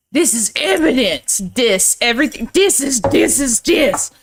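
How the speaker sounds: lightly imitated Louisiana sheriff, rising in pitch; Louisiana accent. Quoting the film's sheriff over evidence, mock Louisiana accent. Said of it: "his Louisiana accent he used for this".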